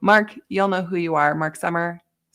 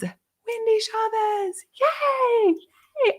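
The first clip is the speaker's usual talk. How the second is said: much higher, slow, sung-out name; excited winner call; much slower than usual. Real, not acted. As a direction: cheer the name out. Announcing a giveaway winner.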